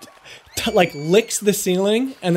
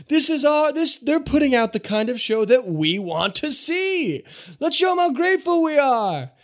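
crowd hype; 8 semitones higher than usual. Acted. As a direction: work the crowd like an MC. Showman riles up the bar.

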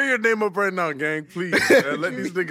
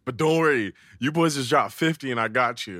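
retold pitch; 7 semitones lower than usual. Retells promoter's pitch to them.

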